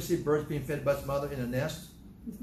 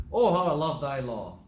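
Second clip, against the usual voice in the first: loud, heartfelt exclamation; emphatic, delighted declaration; with exclamation mark; 5 dB louder than usual. Shown as a demonstration. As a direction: exclaim it with real conviction. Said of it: "He says with an exclamation mark".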